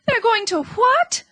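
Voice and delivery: a terrified piglet shrieking; scream. A narrator's reading. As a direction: shriek it in disbelief. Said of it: "screamed Wilbur".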